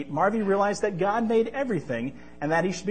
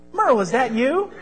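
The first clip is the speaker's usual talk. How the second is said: hopeful excitement; 8 semitones higher, 6 dB louder than usual. Acted. Merle reappears.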